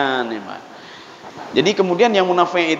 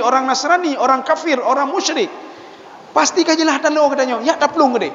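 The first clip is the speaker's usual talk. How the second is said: high-pitched, insistent agitator; Abdullah bin Ubay; 7 semitones higher than usual. Acted. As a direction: stir up the crowd with raised pitch. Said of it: "di depan tiga ratus orang dia ngomong lain". (menghasut orang banyak dengan nada tinggi)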